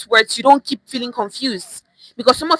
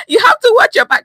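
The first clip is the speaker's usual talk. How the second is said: "You have to watch your back" said much higher through laughter; amused teacher cracking up at her own joke; laughing; 13 semitones higher, 4 dB louder than usual. Real, not acted. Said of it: "you see what I just did there?".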